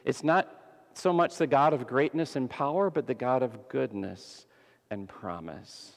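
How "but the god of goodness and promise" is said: ordinary delivery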